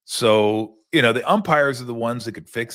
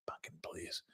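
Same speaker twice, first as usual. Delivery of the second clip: scoffing whisper; 4 semitones lower, 24 dB quieter than usual. Real, not acted. Scoffing at slang.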